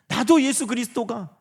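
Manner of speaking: usual speaking voice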